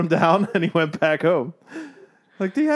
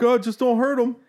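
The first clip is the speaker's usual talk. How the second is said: high-pitched mock dad voice; pleading dad; 10 semitones higher than usual. Imitating kid's dad asking neighbor to go easy, high pleading.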